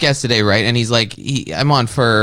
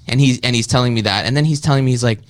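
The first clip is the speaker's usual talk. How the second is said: usual speaking voice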